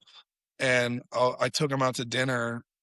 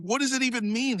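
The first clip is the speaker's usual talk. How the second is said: incredulous question; 10 semitones higher than usual. Retold or imitated. Baffled by band name.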